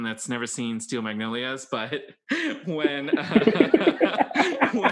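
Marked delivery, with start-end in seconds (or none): 2.3-4.9 laughing. Real, not acted. Laughing while telling spoiler.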